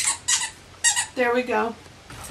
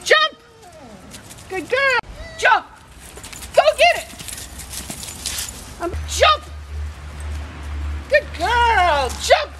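squeaky praising a dog; 8 semitones higher, slower than usual. Real, not acted. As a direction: cheer the dog on high and bright. Praising dog doing tricks.

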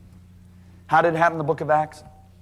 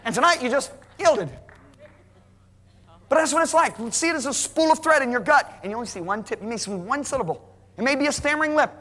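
high, fervent preacher's push; fervent preaching; 10 semitones higher than usual. Acted. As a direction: preach it with rising fire.

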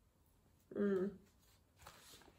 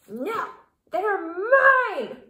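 high, loud grouchy scream; 9 semitones higher, 10 dB louder, much slower than usual. A narrator's reading. Grouchy ladybug claiming the aphids, high loud scream. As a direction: scream it out, possessive. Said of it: "screamed the grouchy ladybug".

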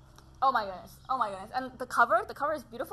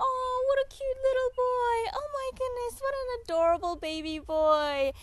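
put-on much higher cooing baby talk; sing-song baby talk to an infant; 13 semitones higher than usual. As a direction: coo at the little one like a doting adult. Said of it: "people being like".

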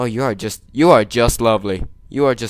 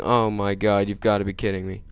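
usual speaking voice

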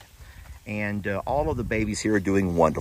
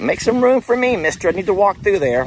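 much higher, bossy animal voice; pushy pig voice; 9 semitones higher, 3 dB louder than usual. Retold or imitated. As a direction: demand the way through like the sow. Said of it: "She's like".

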